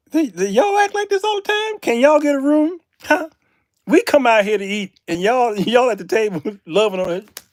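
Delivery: high, loud exasperated retelling; hammed-up indignant outburst; mock scolding. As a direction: fuss at the lovebirds, playing it up. Acted scolding of lovey couple.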